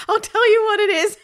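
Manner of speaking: high and loud through giggles; words swallowed by laughter; laughing. Acted. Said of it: "couldn't stop laughing".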